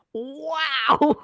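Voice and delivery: high, loud burst of laughing; real laughter. Real, not acted. Host laughs at the quote.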